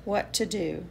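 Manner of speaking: gentle, lively picture-book read-aloud; reading to children. Acted. Author reading her bee book to kids, gentle and lively.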